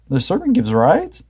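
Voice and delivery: baffled question; puzzled Shaggy. A narrator's reading. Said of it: "said Shaggy".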